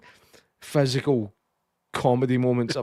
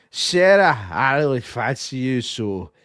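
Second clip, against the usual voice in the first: mock drunk; 4 dB louder than usual. Drunk man propositioning Sarah at the party, mock-drunk delivery. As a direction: play a drunken chat-up, badly misjudged. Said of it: "he's drinking and he walks up to her".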